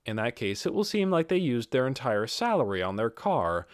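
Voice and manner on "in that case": ordinary delivery, nothing marked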